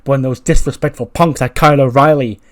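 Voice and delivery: loud wrestling promo delivery; Adam Cole. Acted. Wrestler telling the crowd his story of the year, loud promo voice. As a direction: play the showman on the mic, punching key phrases loudly.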